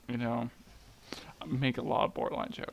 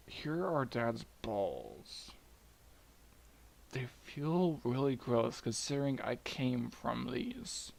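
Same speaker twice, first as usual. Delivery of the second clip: slow, drawn-out reluctant presentation; awkward, grossed-out delivery; 4 dB quieter, slower than usual. Acted. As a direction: hold it out slowly with mock distaste. Kronos awkwardly presents the trophy.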